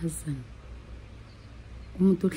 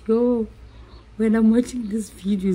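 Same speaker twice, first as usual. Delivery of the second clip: embarrassed; 4 semitones higher, 4 dB louder than usual. Real, not acted. Embarrassed exclamation.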